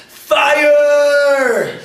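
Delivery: long, high full-voiced yell; yelled out loud. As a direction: drag out a panicked alarm cry. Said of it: "he yelled out loud".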